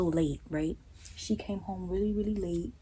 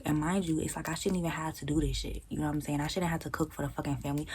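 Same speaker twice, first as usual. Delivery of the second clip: ordinary delivery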